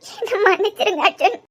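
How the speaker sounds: high tearful apology; weeping, pleading voice; crying. Acted. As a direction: beg forgiveness through sobs. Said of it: "பின்ன அழுதுகிட்டே இரு".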